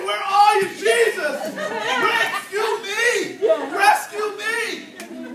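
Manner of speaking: loud, high cry for rescue, slowing down; screamed plea. Acted. Believer in crisis calling on Jesus, screamed plea. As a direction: cry out to heaven, stretching "rescue me". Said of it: "you wanna scream out and say".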